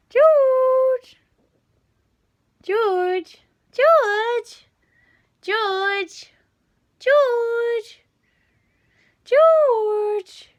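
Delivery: high loud call to a cat. Real, not acted. Owner calling her cat across the room, loud high call. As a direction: sing out the cat's name, high and loud.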